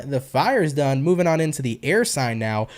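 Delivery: normal talking voice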